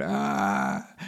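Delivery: loud brief yelp; short frightened cry. A narrator's reading. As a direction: yelp as the tree lurches. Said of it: "I cried out".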